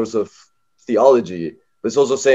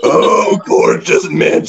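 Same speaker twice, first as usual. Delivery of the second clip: airy, unvoiced falsetto angel; mock-angelic Beatrice in whispery falsetto; angel's voice; 4 semitones higher, much slower than usual. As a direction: put on a hammed-up angelic voice. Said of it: "Angel's voice".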